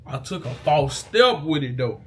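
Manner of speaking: normal talking voice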